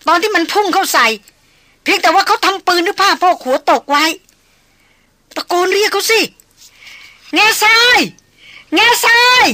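frantic high shouting, building to long screamed calls; ringing shriek. A narrator's reading. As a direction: shout desperately, as if out of her mind. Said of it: "ร้องดังขึ้นในลักษณะกรีดก้อง".

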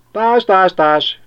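very high, loud, gleeful quacking; vidám kacsák. A narrator's reading. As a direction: quack for joy like a pond of ducks. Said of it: "vidámkodtak a kacsák". (a kacsák vidáman hápognak, magasan és hangosan)

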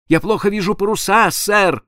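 a scared sailor calling back; frightened helmsman answering his captain; trembling voice. A narrator's reading. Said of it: "странным дрожащим голосом".

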